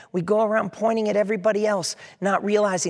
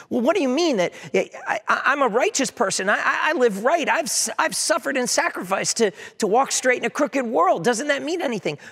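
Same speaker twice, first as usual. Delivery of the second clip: indignant objector; 6 semitones higher than usual. Acted. Self-righteous objector protests.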